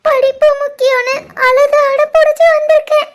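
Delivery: hammed-up sobbing complaint; overacted wailing. Shivani blames her brothers within Ram's hearing, overacted wailing. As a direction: fake a tearful wail, pushing every phrase. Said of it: "இப்படி கதறி கதறி அழுகிற அளவுக்கு".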